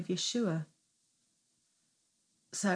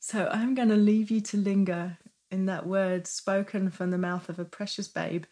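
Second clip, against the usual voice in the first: ordinary talk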